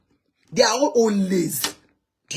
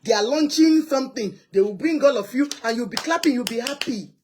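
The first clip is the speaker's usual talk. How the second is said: ordinary talk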